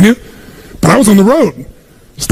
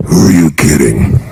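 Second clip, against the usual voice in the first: much lower, slow, mocking doubt; doubting inner voice; slower than usual. The inner critic answering your big goals, low and slow. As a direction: drop into the sneering voice in your head. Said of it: "this voice in your head goes".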